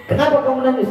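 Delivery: high worried question; high, puzzled concern. Acted. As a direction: ask the weeping man what is wrong. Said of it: "kata orang Yahudi".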